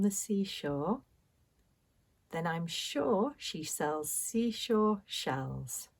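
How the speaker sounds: slow, careful tongue-twister reading; slow and careful, getting each sound right; slowly. Shown as a demonstration. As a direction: take it slowly so every word lands. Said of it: "let's say it slowly".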